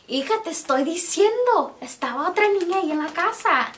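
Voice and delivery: high-pitched Spanish-speaking grandma; insistent grandma. Retold or imitated. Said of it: "let me say it in Spanish".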